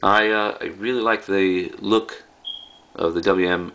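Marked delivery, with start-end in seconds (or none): none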